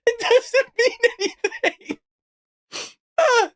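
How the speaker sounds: high words squeezed out through laughing; laughing too hard to speak clearly; helpless laughter. Real, not acted. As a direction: wheeze it out while cracking up. Laughing at what was read.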